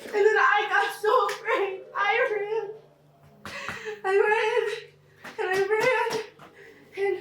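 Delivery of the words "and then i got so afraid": ordinary talk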